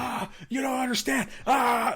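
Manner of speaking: high yelling in mock panic; hammed-up distressed shout; panicked yelling caller. Shown as a demonstration. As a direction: yell it out like he can't cope. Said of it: "you're like".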